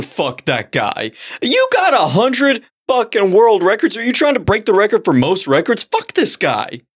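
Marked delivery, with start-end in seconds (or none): 1.4-6.8 indignant rant. Real, not acted. Cohost ranting about the serial record breaker, indignant. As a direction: rant at the rival in disbelief.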